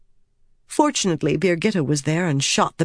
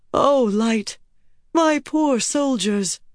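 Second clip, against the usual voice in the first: higher, drawn-out cry of dismay; anguished lament; 7 semitones higher, slower than usual. A narrator's reading. Queen laments her fleeing, dying soldiers, anguished lament. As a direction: lament it, pitched up and slowing. Elaine despairs over fleeing men.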